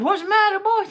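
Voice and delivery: high, loud concerned question; worried mama. Acted. Mama rushes out and asks her boy, high and loud. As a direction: hurry out worried. Mother rushing out alarmed.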